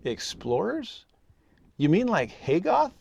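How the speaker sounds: imitated little child, high and questioning, slow first word; cute five-year-old voice. Daughter questioning dad from the back seat, cute high child voice. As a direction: lift it into a small child's puzzled question, swooping up. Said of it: "cute little five-year-old voice from the back seat".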